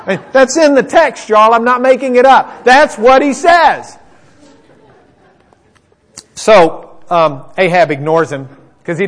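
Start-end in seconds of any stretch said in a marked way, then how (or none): 0.1-4.0 high, loud insistence, slowing at the end; emphatic protest. Real, not acted. Preacher tells y'all he's not making it up, emphatic protest. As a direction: protest loudly that it's true.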